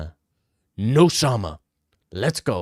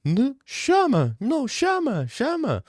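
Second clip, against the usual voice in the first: much higher, slow, pleading mock Pereira; high-pitched; 12 semitones higher, slower than usual. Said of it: "Alex Pereira was high-pitched the entire time".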